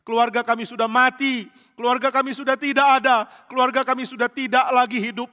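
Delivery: high, loud mock wailing; a hammed-up lament over the dead families; acted weeping lament. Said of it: "Mereka nangis dengan sedih".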